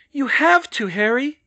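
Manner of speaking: high, loud urging; insistent. A narrator's reading. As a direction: insist across a distance, pitched high. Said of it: "she insisted".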